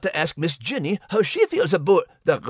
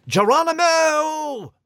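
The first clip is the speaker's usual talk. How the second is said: Geronimo cry; 8 semitones higher, slower than usual. Acted. Jumping cry.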